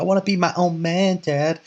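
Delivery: imitating a sulky grown-up kid; upset son. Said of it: "all upset".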